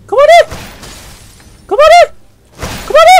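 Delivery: high loud raging shouts; angry yell. Real, not acted. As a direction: rage at the enemies. Yelling at enemies. (화난 고함)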